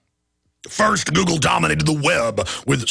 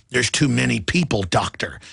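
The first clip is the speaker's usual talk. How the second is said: cold sinister reply; 8 semitones lower than usual. Retold or imitated. Scientist voiced, cold reply.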